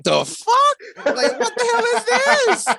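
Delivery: high, loud disbelief breaking into laughter; mock shocked outcry. Host acting out their reaction to the alien, laughing mock shock. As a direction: squeal it in hammed-up bewilderment, laughing.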